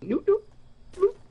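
mocking quote. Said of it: "He went".

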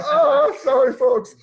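high, loud laughing sorry; laughing apology. Real, not acted. Laughs at own digression.

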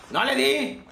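high, loud annoyed yell; frustrated shout. Real, not acted. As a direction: shout at the missed shot. Missed shot.

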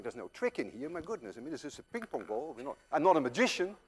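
normal talking voice

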